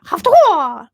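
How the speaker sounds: loud high martial arts shout; loud karate yell; kung fu cry. Retold or imitated. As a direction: bark it like a ninja kicking. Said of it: "like this real kung fu ninja kick".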